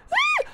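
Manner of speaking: very high-pitched squeal. Real, not acted. Streamer rejecting an outfit, high squealed cry. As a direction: let out a sudden tiny shriek.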